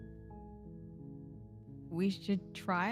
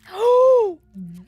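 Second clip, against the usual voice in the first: big startled gasp; sharp loud gasp; 12 semitones higher, 14 dB louder than usual. Real, not acted. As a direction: gasp in shock. Shocked by the bloody scene.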